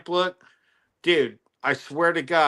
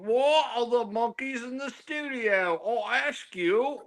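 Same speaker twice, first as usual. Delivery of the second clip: lightly put-on Mexican accent; 7 semitones higher than usual. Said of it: "Was that a Mexican accent".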